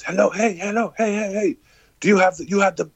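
put-on high, eager shout of an older shopkeeper; calling out excitedly. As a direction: wave him down with high-pitched calls. Shop owner runs out calling.

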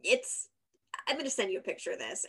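normal talking voice

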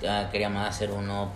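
normal talking voice

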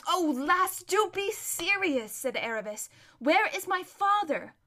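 high, pleading impatience; drawn-out frustrated appeal; exasperated. Acted. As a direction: beg her to be serious. Runaway girl impatient with friend.

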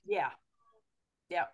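ordinary talk

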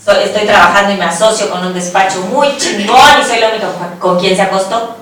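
ordinary delivery

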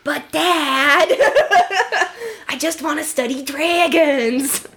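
mock teenage protest; whiny teen Hiccup. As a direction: plead with dad through laughter. Playing Hiccup whining to dad.

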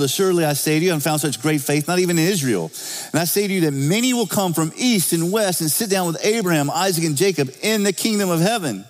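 preacher proclaiming a quoted passage; scripture reading. Acted.

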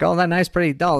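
high squeaky salesman. Acted. Squeaky sharpener scoffing at a dull knife. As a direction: squeak out the complaint. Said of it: "My, my squeaky voice is terrible".

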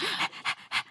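quick breathy doggy pants into the mic; panting like a dog. Pretend. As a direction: pant like an excited puppy. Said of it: "that was me panting like a dog".

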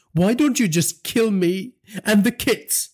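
high, slow, desperate plea; wife pleading tearfully with her violent husband. A narrator's reading. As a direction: beg through tears. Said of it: "Ravina said tearfully".

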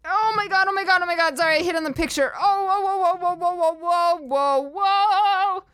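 high startled whoas; real shock at the picture. Real, not acted.

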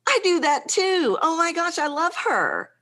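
high-pitched excited fan; delighted gush. Retold or imitated. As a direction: squeal like a thrilled client. Client delighted by relatable quirk.

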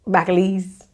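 Bacalise said for [name]; name garbled into Bacalise; mispronounced name. Real, not acted. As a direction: stumble on the name, saying Bacalise. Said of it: "I have accent problems".

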